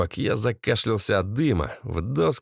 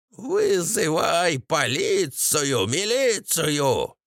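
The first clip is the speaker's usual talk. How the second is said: slow, drawn-out order, pitched up; commanding mother-in-law; 4 semitones higher, much slower than usual. A narrator's reading. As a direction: order slowly as the old shrew. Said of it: "велела тёща". (тёща командует нараспев)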